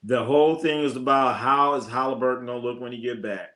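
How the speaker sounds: ordinary talk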